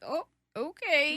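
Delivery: high, drawn-out "oh, okay"; awkward backing off. Retold or imitated. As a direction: imitate someone warily backing away. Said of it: "just kinda awkwardly back up".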